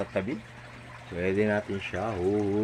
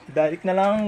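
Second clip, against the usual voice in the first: urgent; 10 semitones higher, 8 dB louder than usual. Real, not acted. Hurrying with the fish.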